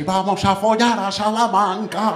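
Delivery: high, drawn-out rallying cry; high and slow, stretching each word; mock excited crowd. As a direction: call it out like a carload of revellers. Acts carload heading out excitedly.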